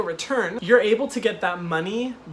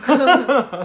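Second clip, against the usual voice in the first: high burst of laughter; 7 semitones higher, 10 dB louder than usual. Real, not acted. Laughing at the story.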